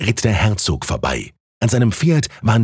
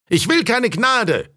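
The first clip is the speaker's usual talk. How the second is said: high, slow drawn-out call; heckler calling back; 13 semitones higher, slower than usual. A narrator's reading. Heckler answers the other spectator, high stretched call. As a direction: call the refusal back, pitched way up. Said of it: "lautete die Antwort".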